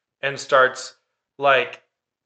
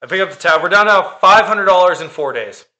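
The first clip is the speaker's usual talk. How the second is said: louder and higher, hammering the five hundred; exasperated emphasis; 6 semitones higher, 8 dB louder than usual. Real, not acted. Venting to co-host about the cost, exasperated loud emphasis. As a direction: vent the cost, pressing every word. Irritated retelling of money spent.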